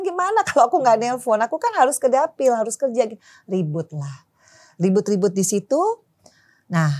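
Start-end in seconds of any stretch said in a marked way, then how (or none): 0.1-3.0 higher and louder, arguing her case; insistent protest. Retold or imitated. Wife arguing with jealous husband about work trips, raised protest. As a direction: push back hard, defending her work.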